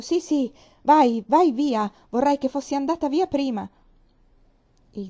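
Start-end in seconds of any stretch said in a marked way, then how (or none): 0.0-3.7 loud, slowed shooing, higher; mimicking aunt's dismissal. Girl repeating how her aunt sent her away, mock irritated.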